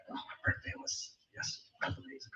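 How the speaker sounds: breathy whispered aside, very soft; hushed whisper. Real, not acted. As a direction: drop to a whisper for a quick aside. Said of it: "aside while reading".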